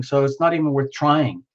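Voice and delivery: ordinary delivery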